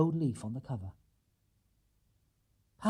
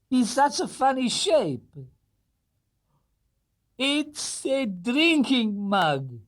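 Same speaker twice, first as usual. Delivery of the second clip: put-on very slow, higher accented old man; delighted Mr Gruber; 10 semitones higher, 4 dB louder, much slower than usual. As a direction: marvel at the gift. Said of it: "he exclaimed".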